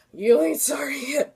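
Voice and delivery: mock tough-girl warning; tough threat. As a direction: throw it at him like a dare. Threatening the heavy victim.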